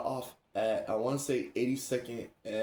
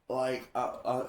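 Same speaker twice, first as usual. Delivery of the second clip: normal talking voice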